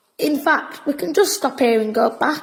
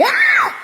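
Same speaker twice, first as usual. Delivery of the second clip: sharp startled scream, much higher and louder; sudden scream; 3 dB louder than usual. Acted. Boy screams in fright as the cave seems to give way.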